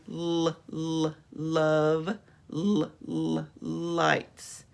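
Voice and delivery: two held "l" sounds before "love" and "lights"; beginning sound isolated. Shown as a demonstration. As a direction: sound out the L, then each word. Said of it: "Did you do the beginning sound".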